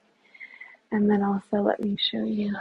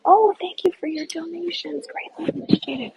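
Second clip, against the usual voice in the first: delighted surprise; 6 semitones higher than usual. Real, not acted. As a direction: caught off guard by a gift, light up. Surprised by a live donation.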